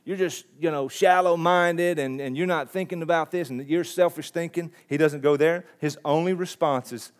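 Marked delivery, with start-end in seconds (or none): 0.0-4.7 a sneering put-down in a mildly put-on voice; half-hearted condescending jab; mock nasty attitude. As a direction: play the snide scoffer, lightly. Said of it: "have a nasty attitude toward Nathanael and say".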